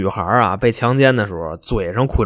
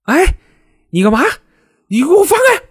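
high-pitched startled cry, let go of me; acted alarmed yell, jumping high in pitch; startled angry protest; 4 dB louder than usual. Grabbed woman yells at attacker.

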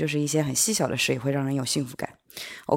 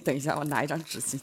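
ordinary talk

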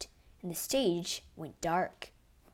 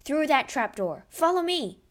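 loud, high, urgent order; rally them to the chase; yelling; 7 semitones higher, 7 dB louder than usual. A narrator's reading. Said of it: "he yelled".

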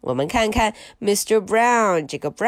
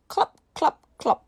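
1 hooves clopping; 6 semitones higher, faster than usual. Pretend. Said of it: "他还会学马走路的声音".

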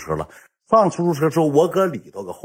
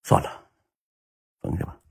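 resigned giving up; 7 semitones higher, 13 dB quieter, faster than usual. Retold or imitated. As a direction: let the words fade out, defeated. Retells giving up and breaking up. (低声无力地说算了)

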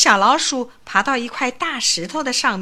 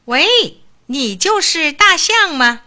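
cocky call; 6 semitones higher, 7 dB louder, slower than usual. A narrator's reading. Mouse hails the beetle.

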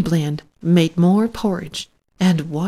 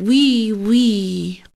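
little pig squeak; 3 semitones higher than usual. A narrator's reading. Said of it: "And the little pig said".

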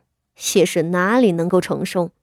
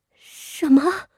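higher, bewildered question; 7 semitones higher than usual. Acted. The wife cannot understand her mother-in-law, bewildered. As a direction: she cannot follow what she hears. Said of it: "谢氏听不懂了".

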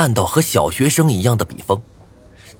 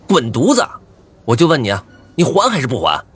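angry demand; 3 semitones higher than usual. Acted. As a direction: demand it back in a rage. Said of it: "我怒极反笑".

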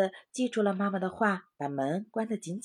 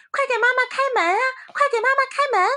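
very high, loud and fast; eager urging; 8 dB louder, faster than usual. A narrator's reading. Short Tail urges opening the door, very high and loud. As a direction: rush the others to open up.

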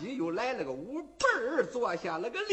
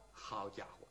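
plain reading voice

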